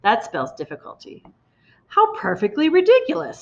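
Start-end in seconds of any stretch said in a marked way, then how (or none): 1.9-3.4 high, scornful snort of a line; mocking bully headmistress. A narrator's reading. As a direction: sneer at the idea. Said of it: "snorted the Trunchbull".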